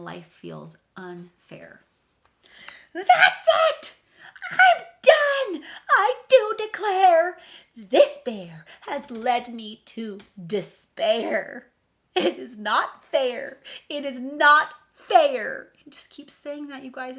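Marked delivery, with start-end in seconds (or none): 2.9-11.7 high, loud wailing tantrum; a fed-up mouse losing it completely; mouse's outburst. Acted. The mouse gives up on the bear, wailing furiously. 12.1-13.6 loud, slow, drawn-out whine; mouse's complaint. Acted. The mouse complaining that life is unfair, in a slow loud whine. 13.9-15.7 even louder, slow wailing protest on not; mouse's louder complaint. Acted. The fed-up mouse repeating its complaint, in a louder wail.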